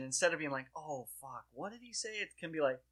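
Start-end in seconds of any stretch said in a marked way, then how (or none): 0.8-2.1 quiet, dreading "Oh, fuck, what did he say?". Shown as a demonstration. Host shows the old panicked reaction, quiet dread. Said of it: "There's a, there's a lightness".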